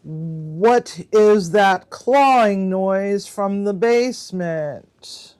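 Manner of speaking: drawn-out, slow question; slow spooky card read. Shown as a demonstration. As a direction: read the prompt slowly, building suspense. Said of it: "reading the card prompt".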